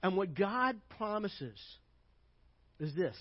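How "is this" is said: ordinary talk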